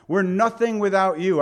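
loud emphatic declaration; forceful restatement. Real, not acted. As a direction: proclaim it boldly. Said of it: "restating with force".

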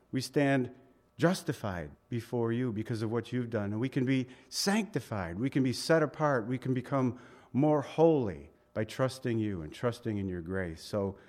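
hushed sermon prayer. Acted. Pastor leads a prayer.

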